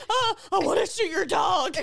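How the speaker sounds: high, loud impression breaking into laughter; mock dirty talk. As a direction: imitate clumsy seduction while cracking up. Imitates Michael's failed dirty talk.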